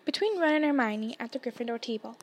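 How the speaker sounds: usual speaking voice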